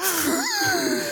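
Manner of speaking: sharp high gasp. Retold or imitated. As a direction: gasp in shock, pitched high. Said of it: "She just goes".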